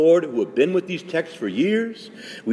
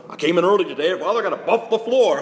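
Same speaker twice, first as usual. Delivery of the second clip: higher, chatty workman with a laugh; buffer guy; 5 semitones higher, 3 dB louder than usual. Retold or imitated. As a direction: mimic the cheerful floor cleaner. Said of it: "guys running the buffer".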